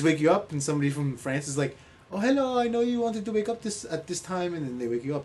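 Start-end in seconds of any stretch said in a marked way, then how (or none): 2.1-4.5 lightly put-on French accent. App caller from France waking someone up, French accent. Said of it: "That's your French accent?".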